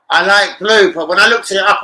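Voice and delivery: a loud animated confession; excited rant. Real, not acted. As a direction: blurt it out, half laughing. Laughing rant about wall colour.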